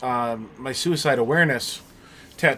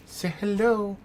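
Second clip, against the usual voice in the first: talking to a dog; 7 semitones higher than usual. Real, not acted. Coaxing dog, higher.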